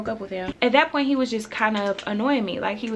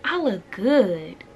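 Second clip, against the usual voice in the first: sassy self-praise; 2 semitones higher, much slower than usual. Retold or imitated. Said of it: "so I'm like".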